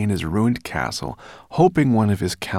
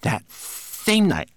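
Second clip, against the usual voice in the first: high, slow, declaimed tale; recited; 14 semitones higher, 4 dB louder, much slower than usual. A narrator's reading. Manikin telling his master's legend, reciting high and slow. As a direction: recite grandly in a small servant voice. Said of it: "he continued".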